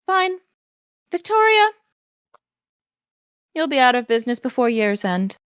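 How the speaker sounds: haughty noblewoman. Acted. Lucinda drawing herself up to threaten the shopkeeper, lofty. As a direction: draw herself up and look down on her. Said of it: "holds herself up and goes".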